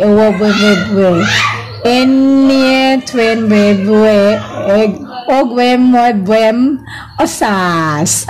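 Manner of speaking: slow, stretched tongue-twister syllables; laboured careful reading. Real, not acted. Streamer shouting out a hard name, slow and careful. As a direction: draw out "Enye Twen" to get through it. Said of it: "Ang hirap pasahin".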